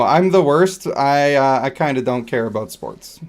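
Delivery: ordinary talk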